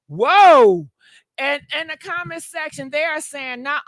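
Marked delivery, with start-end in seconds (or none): 0.1-0.9 a sudden, much louder gasp of shock; loud shocked whoa. Real, not acted. Reacting to the [name] tape claim, loud shocked whoa. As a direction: blurt it out, floored by the news.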